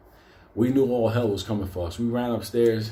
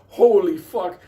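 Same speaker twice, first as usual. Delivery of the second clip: shocked; 8 semitones higher, 8 dB louder, slower than usual. Retold or imitated. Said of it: "We were like".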